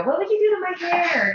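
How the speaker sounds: high-pitched, pestering question; squeaky mock small talk; mock annoying stranger. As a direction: imitate a pushy new acquaintance up high. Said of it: "it's just annoying when you meet someone".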